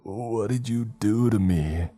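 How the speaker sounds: slow, groggy, drugged slurring; slurred. A narrator's reading. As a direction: drag every word out half-conscious. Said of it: "he slurred".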